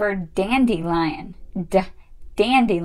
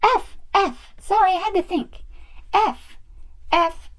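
high little doll voice; Peach; 7 semitones higher than usual. Pretend. Doll answering after thinking, high-voiced pupil. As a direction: answer in a quick, pitched-up toy voice.

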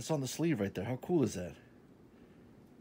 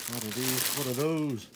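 hammed-up, higher and louder; mock meme shout; 4 semitones higher, 6 dB louder than usual. Joking to viewers about his new pants, hammed-up loud voice. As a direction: bellow it like a meme.

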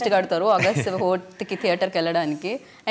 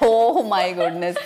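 laughing; 5 dB louder, much slower than usual. Real, not acted. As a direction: laugh it out, stretched. Laughing at teasing answer.

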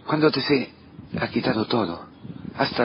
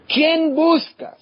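angel's question; 13 semitones higher, 3 dB louder, much slower than usual. Acted. Angel's question voiced in the story.

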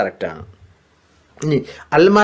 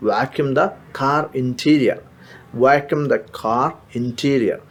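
slow model phrase; 4 dB quieter, much slower than usual. Shown as a demonstration. Teacher modelling a new word slowly for students. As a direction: stretch out each word of declutter the closet. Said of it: "കാറിൻ്റെ ഉൾവശം vacuum ചെയ്യുക".

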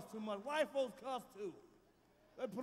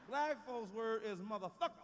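ordinary delivery

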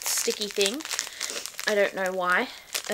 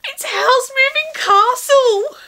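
much higher, louder, slow gushing cry of joy; overjoyed; 13 semitones higher, 7 dB louder, slower than usual. Real, not acted. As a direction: squeal it out, near tears with delight. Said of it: "I'm not crying".

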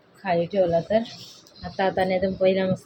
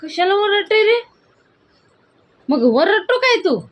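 higher, louder scolding; 11 semitones higher, 4 dB louder than usual. Real, not acted. Mother scolds yelling child.

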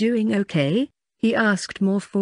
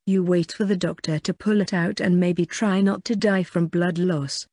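ordinary delivery

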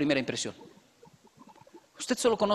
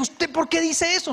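skeptical wife; 9 semitones higher, 3 dB louder than usual. Retold or imitated. Wife asks, voiced higher.

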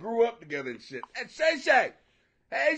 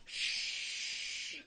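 shushing; 13 dB quieter than usual. Real, not acted. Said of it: "Don't you say a damn thing".

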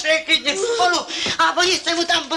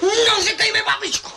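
higher, half-sobbing reproach; tearful; 6 semitones higher than usual. Acted. Grandmother bitterly echoing her grandson, close to tears. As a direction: let the tears break into the words. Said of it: "Nebreč, nebreč".

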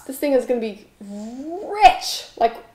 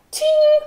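high-pitched sung "ting"; imitated timer ding; 4 dB louder than usual. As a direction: ring out like a little bell.

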